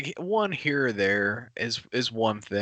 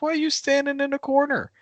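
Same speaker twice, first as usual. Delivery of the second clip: high incredulous. Retold or imitated. Retold talk to crying nephew.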